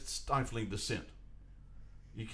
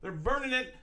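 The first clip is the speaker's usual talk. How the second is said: "burning" pitched high in disbelief; incredulous echo; 11 semitones higher than usual. Real, not acted. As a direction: echo it back, high and outraged. Outraged echo of flag burning.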